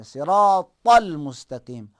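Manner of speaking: صراط المستقیم with a heavy ص, slow and loud; heavy saad recitation. Shown as a demonstration. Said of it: "صراط نہیں پڑھیں گے".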